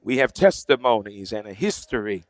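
public prayer building in force, then easing at the close; fervent prayer. Real, not acted. Preacher praying to God for those at the gate, fervently. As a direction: pray aloud with conviction, pressing each plea.

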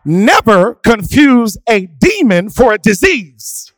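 loud, high, sung-out sermon cadence; preaching at full force. Acted. A pastor calling his church to face demons, fervent and loud. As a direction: drive it home with whooping fervour.

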